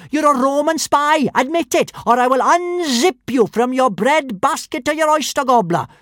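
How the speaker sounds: put-on high, loud little-girl threat; fierce threat. A narrator's reading. Girl threatens Hiccup with sword.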